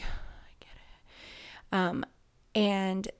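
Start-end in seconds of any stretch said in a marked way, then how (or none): 0.0-1.8 breathy whispered "I get it"; whispered aside. Retold or imitated. To herself while reading about eating disorders, a slow whisper. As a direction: half-whisper it, slow and resigned.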